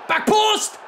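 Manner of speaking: high, loud call. Real, not acted. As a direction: bellow the instruction at the screen. Calling cross destination.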